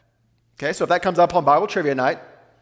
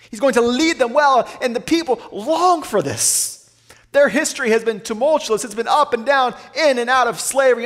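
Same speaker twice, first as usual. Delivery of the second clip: raised, fervent preaching voice; impassioned preaching; 5 semitones higher than usual. Acted. Preacher stirring his listeners with rising fervour. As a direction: lift the congregation with the promise.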